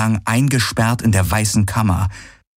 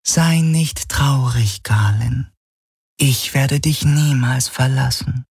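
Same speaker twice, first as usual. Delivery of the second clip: slow, higher figure voice, soothing; tender shadow apparition, consoling; 5 semitones higher, slower than usual. Acted. Illusion vows never to leave Galen, tender. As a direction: promise it gently and slowly.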